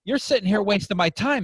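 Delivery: put-on high-pitched, lightly Italian-accented father. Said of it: "kinda Italian accent".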